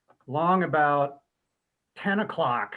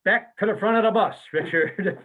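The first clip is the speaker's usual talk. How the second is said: louder, higher mock group shout; band shouting back; 5 semitones higher, 5 dB louder than usual. The band jokingly shouts Richard back to the front. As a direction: send him off with a playful holler. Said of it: "we all looked at him and went".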